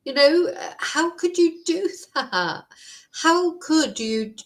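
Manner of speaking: high-pitched, incredulous outrage; real indignant disbelief; angry. Real, not acted. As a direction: demand to know how anyone could, voice rising high. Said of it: "I am just so angry".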